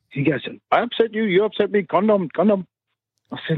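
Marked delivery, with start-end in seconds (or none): 0.7-2.7 mimicked cab driver. Retold cab driver arguing.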